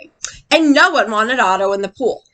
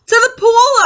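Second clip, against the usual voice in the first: high, loud yell echoing the fireman; 10 semitones higher, 5 dB louder, slower than usual. Acted. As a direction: shout back urgently. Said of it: "I yelled".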